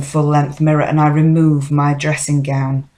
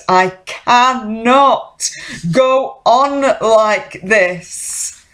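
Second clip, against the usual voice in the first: high, slow, drawn-out despair; anguished declaration at breaking point; desperate climax; 7 semitones higher, much slower than usual. Acted. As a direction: stretch each word out at a raised pitch.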